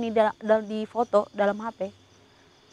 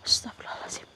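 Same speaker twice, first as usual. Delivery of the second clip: a voiceless, shaken whisper; 7 dB quieter, faster than usual. Real, not acted. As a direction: whisper it under your breath. Whispered in shock.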